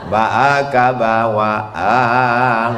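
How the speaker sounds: very slow "Baaka bawaang" with stretched vowels; slow stretched Minang; Minang drawl. Shown as a demonstration. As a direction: draw out each vowel Minang-style. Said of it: "Bahasa Minang ada pakai intonasi panjang?".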